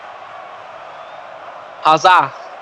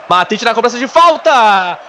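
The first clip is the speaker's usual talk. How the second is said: excited commentary; 4 semitones higher than usual. Real, not acted. Free kick goal build-up.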